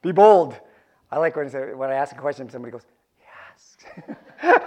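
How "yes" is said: a breathy mock whisper of a shy answer; whispered yes. Preacher imitating a congregant whispering an answer. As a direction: whisper it like a timid churchgoer. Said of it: "whispered it".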